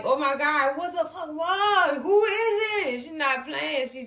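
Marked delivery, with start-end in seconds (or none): 0.0-3.0 irritated mock outburst, climbing in pitch; imitated attitude. Fatima answering a knock at the door, mock attitude. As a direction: act out fed-up door answering, getting higher. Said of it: "answered the door with an attitude like".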